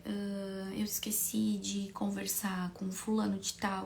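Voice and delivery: normal talking voice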